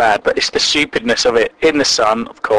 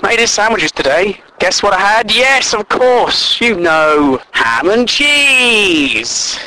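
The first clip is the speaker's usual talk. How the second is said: sarcastic drawl; 4 semitones higher, 8 dB louder than usual. Pretend. Mock-weary sandwich rant.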